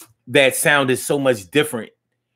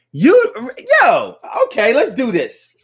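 hyped up; 13 semitones higher, 5 dB louder than usual. Real, not acted. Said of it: "hype opener".

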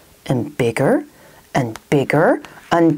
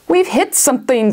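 captain voice; 7 semitones higher than usual. Acted. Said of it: "said Captain Galacticus".

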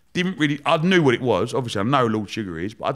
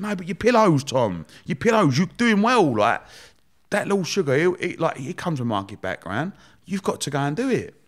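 imitating wife; 6 semitones higher than usual. Wife pleading with Tom to apply, in a higher mock voice. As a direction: push him like an insistent partner.

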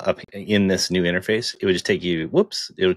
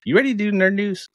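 hyped-up; 13 semitones higher, 3 dB louder than usual. Real, not acted. Hyping the news segment.

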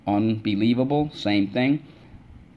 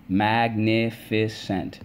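"Magnificent" stretched slowly as mag-nif-i-cent; syllable by syllable; much slower than usual. Shown as a demonstration. Teacher leading the class, slowly syllable by syllable. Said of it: "let's go".

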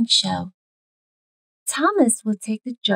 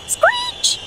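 high squealing screech of brakes. A narrator's reading. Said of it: "Go the brakes".

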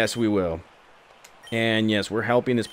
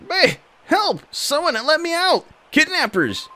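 calling for help. Acted. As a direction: shout for help, hammed up. Captive girl calling from sack.

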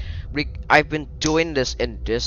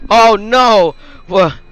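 loud startled oh no; shocked cry; 10 semitones higher, 12 dB louder, slower than usual. Real, not acted. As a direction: yelp in surprise. Sudden shock in game.

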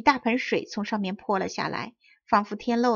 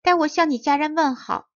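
high, louder little chick voice; a cheerful tiny chick calling out; 6 semitones higher, 6 dB louder than usual. A narrator's reading. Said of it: "小鸡说".

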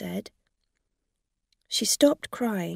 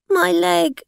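higher, louder pained wail; crying; 11 semitones higher, 9 dB louder than usual. Acted. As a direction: wail about the leg, faking hurt. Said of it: "She began to cry".

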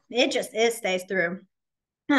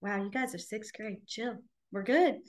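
put-on calm the kids down quietly; unimpressed teacher, softly; teacher shrugging off sixth grade drama; softer, dry; 2 semitones lower, 5 dB quieter than usual. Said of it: "And I'm like".

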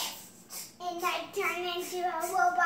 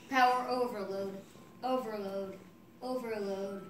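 robot winding down, softer; powering-down robot; 10 semitones lower, 7 dB quieter than usual. Pretend. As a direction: fade out like a dying robot. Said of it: "he's having a power loss".

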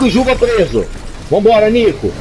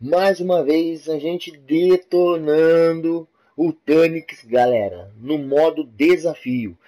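softer, relaxed channel host read; YouTuber intro; 7 semitones lower, 7 dB quieter than usual. Acted. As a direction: talk to your subscribers like a host. YouTuber intro and outro to viewers. (apresentador de canal, tom descontraído)

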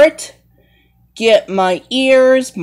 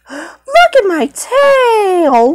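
slow, higher show-off; slow high cat voice; 7 semitones higher, slower than usual. Pretend. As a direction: show off the tail slowly in a higher voice. Aristocats character showing tail.

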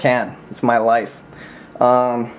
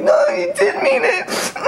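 hammed-up wailing sob, far higher and slow; mock crying; slower than usual. Mocking Edwin for crying on Skype, whiny mock sob. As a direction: mimic a friend bawling on Skype, whiny and slow. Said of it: "And he'll be like".

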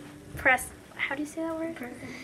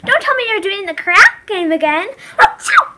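high, loud nagging with sneeze; Adelaide impression; 8 semitones higher, 11 dB louder than usual. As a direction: nag then sneeze. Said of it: "my best Adelaide impression".

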